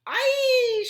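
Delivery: very high, loud shriek. A narrator's reading. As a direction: shriek it at the top of the voice. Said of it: "shrieked Flap".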